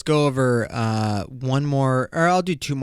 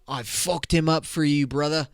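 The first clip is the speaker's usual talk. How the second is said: mock British accent; 3 semitones higher than usual. Guy reports punching the boyfriend, mock British accent. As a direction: play the British protector. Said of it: "Bruv, they say bruv".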